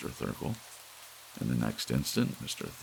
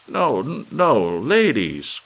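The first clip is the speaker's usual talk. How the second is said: high, much louder, slowing pleading cry; frantic cry; 13 semitones higher, 13 dB louder, slower than usual. A narrator's reading. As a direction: stop the crowd in a panic. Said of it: "cried Mister Thurkell".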